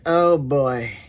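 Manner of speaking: low, dry mock groan. Kay unimpressed as Lori stands up, low and dry. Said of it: "Kay was like".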